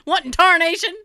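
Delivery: put-on high hokey old-timer voice; folksy yelp, like an old miner; old prospector. Continuing hokey old-timer bit.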